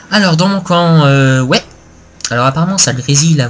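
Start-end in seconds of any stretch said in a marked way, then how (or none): none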